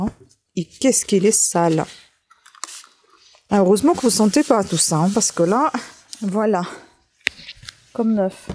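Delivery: normal talking voice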